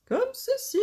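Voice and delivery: high-pitched playful lilt; sing-song. Real, not acted. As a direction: show it off in a high singsong. Sing-song showing of a craft step.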